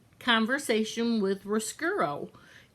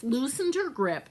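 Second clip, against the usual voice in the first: ordinary delivery, nothing marked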